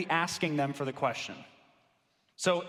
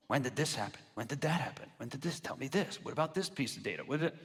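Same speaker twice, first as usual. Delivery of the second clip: flat, quieter rapid-fire questions; interrogating interviewer; 3 semitones lower, 6 dB quieter than usual. Shown as a demonstration. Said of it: "That is interview fact-finding".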